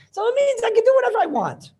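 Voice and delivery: high, loud, exclaimed argument; mock triumphant conclusion. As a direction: exclaim it as an over-the-top conclusion.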